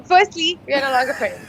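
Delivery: usual speaking voice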